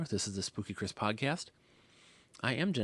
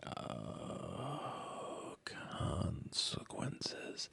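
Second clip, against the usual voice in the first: whispered dread; 7 dB quieter, much slower than usual. Pretend. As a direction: breathe out "consequences" as a slow, hushed warning. Imagining what will happen.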